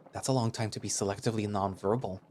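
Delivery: ordinary delivery, nothing marked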